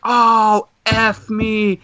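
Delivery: high mock dismay on oh; dismayed groan. Fan realizing he got no autographs, high dismay.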